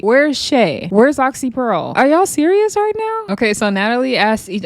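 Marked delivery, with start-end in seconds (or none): 2.0-3.3 very high-pitched disbelieving question; incredulous. Real, not acted. Objecting to the girls picked, incredulous. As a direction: challenge them, voice leaping up high.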